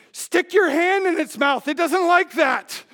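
high, loud shouted instruction; shouting from the river; urgent shout. Retold or imitated. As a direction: yell it across the water. Shouting advice during croc attack.

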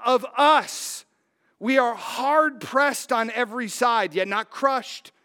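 loud, measured preacher's reading; preaching Second Corinthians to the congregation; scripture reading. Acted. Reads scripture with preaching force.

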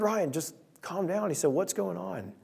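soft, slow calming voice; softly voicing a calm friend. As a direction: gently settle him down, quietly.

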